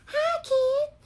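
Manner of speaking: very high squeaky Barbie voice; [name] quote. Retold or imitated. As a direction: squeak it like the doll in the song. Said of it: "they're from the song [name]".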